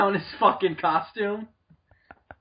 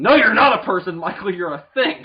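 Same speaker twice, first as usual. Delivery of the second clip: mock scolding; 3 semitones higher, 7 dB louder than usual. Talking back to the imagined actor, mock scolding. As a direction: shout him down in jest.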